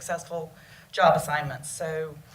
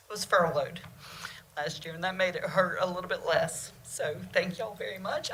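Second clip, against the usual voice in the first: getting emotional; 5 dB quieter than usual. Real, not acted. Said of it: "I don't know why I'm getting so emotional".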